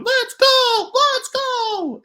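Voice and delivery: put-on very high, loud squeal of a kid; screaming little kid. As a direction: imitate an overexcited child cheering. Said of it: "this little kid's going".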